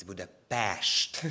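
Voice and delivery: "Budapest" slowly, ending in a drawn-out sht; correcting pronunciation. Retold or imitated. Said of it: "No, it's Budapest".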